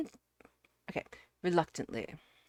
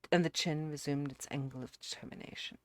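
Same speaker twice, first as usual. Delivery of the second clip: plain reading voice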